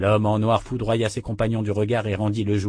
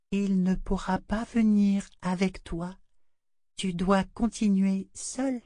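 high, slow, hushed doll voice; a doll breaking hard news gently; murmuring doll; 10 semitones higher, slower than usual. A narrator's reading. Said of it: "le murmure de sa poupée". (murmure lent de poupée)

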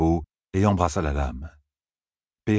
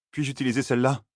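lightly voiced young man, higher pitch; a higher, tentative question; young man asking; 6 semitones higher, faster than usual. A narrator's reading. Aram asks Perrine if he may use the sword, young man asking.

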